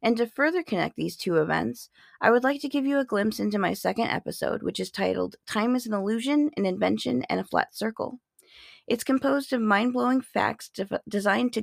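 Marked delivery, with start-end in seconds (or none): none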